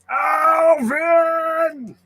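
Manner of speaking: high drawn-out yell of a name; exasperated shout. Retold or imitated. Cartoon dad yelling at his chipmunk son. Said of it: "Going around going".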